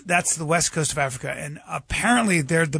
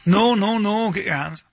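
Wayne McCulloch; 4 semitones higher than usual. Retold or imitated. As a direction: imitate a Belfast coach's urgent correction. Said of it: "from Belfast, and he tells me".

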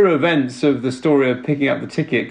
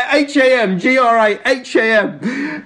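laughing spelling; 7 semitones higher than usual. Retold or imitated. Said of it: "while you were like".

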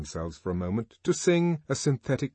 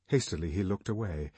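ordinary delivery, nothing marked

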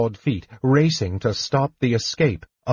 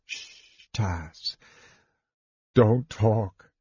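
soothing hush; 4 dB quieter, much slower than usual. A narrator's reading. Soothing dying friend.

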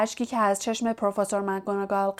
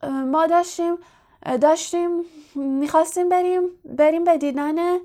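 higher, stumbling, repeating words; stammering; 7 semitones higher than usual. A narrator's reading. Ron to McGonagall, stammering when caught in the corridor. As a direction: caught out and tripping over the words. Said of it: "رون با لکنت گفت".

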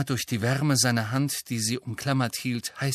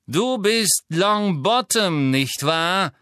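higher, louder slow sneer; 6 semitones higher, 4 dB louder, much slower than usual. A narrator's reading. Malfoy taunting captured Neville, slow sneer. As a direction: taunt the captive boy. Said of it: "höhnte Lucius Malfoy".

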